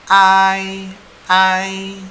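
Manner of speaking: "I" as a long "ai", high, loud and level; diphthong ai. Shown as a demonstration. Teacher modelling A plus I, loud long "I". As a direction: stretch "I" like a held note sliding from A to I. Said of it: "A dan I bacanya I".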